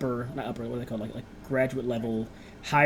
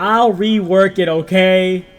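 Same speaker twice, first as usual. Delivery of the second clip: slow, much louder insistence; slow, much louder, higher pushback; loud and defensive; 7 semitones higher, 15 dB louder, much slower than usual. Real, not acted. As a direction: cut the complaint off, firmly. GM defends accent choices.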